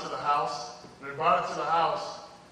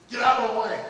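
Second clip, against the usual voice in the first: louder, higher command; retold shout; 6 semitones higher, 7 dB louder than usual. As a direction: push through the crowd with a shout. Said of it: "she could hear".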